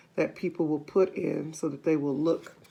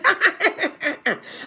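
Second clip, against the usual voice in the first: high witchy cackle; witch cackle; 10 semitones higher than usual. A narrator's reading. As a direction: cackle like a wicked old witch. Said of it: "cackled Missus Twit".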